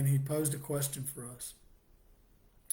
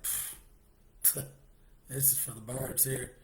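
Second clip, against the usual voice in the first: scoffing laugh; 3 semitones lower, 9 dB quieter, much slower than usual. Retold or imitated. Scoffing at reading the Bible.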